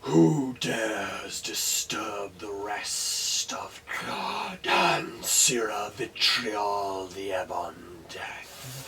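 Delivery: slow, loud, breathy undead boom; hollow booming voice. Acted. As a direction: boom it from a distant tomb. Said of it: "a hollow voice booms from beyond".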